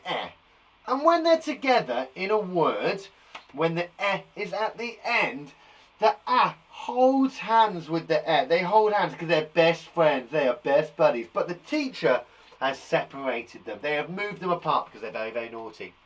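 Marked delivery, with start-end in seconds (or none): 6.0-11.3 slow, storybook-style explaining. Shown as a demonstration. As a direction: spell it out slowly for kids. Explaining to children.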